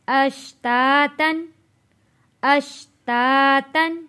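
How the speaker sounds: "अश्तातन" said slowly as one word, no nasal hum on the tanween; joined recitation. Shown as a demonstration. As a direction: read "अश्तातन" linked without ghunna. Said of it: "हम इसको पढ़ेंगे". ("अश्तातन" को जोड़ कर, बिना गुन्ना, धीरे पढ़ें)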